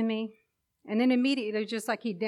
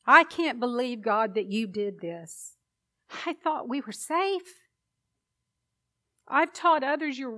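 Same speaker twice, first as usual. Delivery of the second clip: raised, higher anguished crying out at God; yelling at God; 4 semitones higher, 6 dB louder than usual. Retold or imitated. Grieving mother yelling at God after Jacob died, anguished loud shout. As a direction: pour grief and anger out at God, loud. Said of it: "I was yelling at God".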